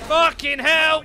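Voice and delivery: loud frustrated swearing; frustrated shout. Real, not acted. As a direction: yell it out in frustration. Attacked in game.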